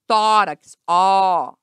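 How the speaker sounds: Tórax with a loud, open ó; ó held open and loud. Shown as a demonstration. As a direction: punch the open first vowel of Tórax, then isolate ó.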